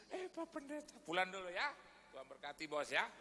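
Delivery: high, startled farewell; lightly voiced flustered boss; boss's hasty goodbye. Boss hurriedly says goodbye. (pamit tergesa-gesa bernada tinggi)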